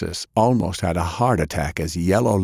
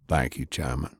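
lower, quiet gruff man; low, soft Sullivan; 5 semitones lower, 6 dB quieter than usual. A narrator's reading. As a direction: say it low and held back. Sullivan answers the Chairman.

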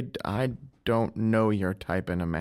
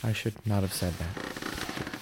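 regretful; 4 semitones lower, 6 dB quieter, slower than usual. Acted. Husband regrets remark about sight.

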